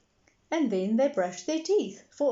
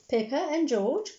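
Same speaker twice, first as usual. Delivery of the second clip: calling out; 6 semitones higher, 4 dB louder than usual. A narrator's reading. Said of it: "called Daddy Pig".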